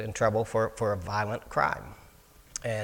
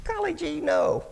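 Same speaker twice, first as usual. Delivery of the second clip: much higher, naive cartoonish squeak; goofy gee-whiz voice; 4 dB louder than usual. Teen counselor answering the pretty cook, high goofy Elmer Fudd-like voice. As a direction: play the dopey kid caught off guard. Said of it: "Literally, I said that".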